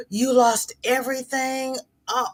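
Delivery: shocked disbelief. Real, not acted. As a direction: ask it as if you can't believe it. Hears friend lost house.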